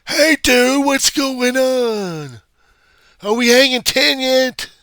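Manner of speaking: very high, loud, drawn-out Malibu surfer-dude voice; mock Malibu accent. Mimicking a Malibu dude greeting a buddy, exaggerated Malibu surfer accent. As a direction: stretch it out like an over-the-top Malibu surfer. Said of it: "talk like I'm coming from Malibu".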